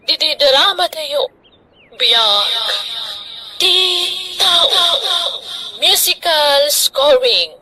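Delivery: slow, measured announcer read; radio announcer. Acted. Host closing the drama and thanking listeners, formal announcer voice.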